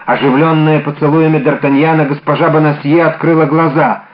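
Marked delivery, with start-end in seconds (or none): none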